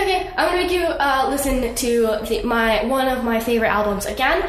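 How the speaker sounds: usual speaking voice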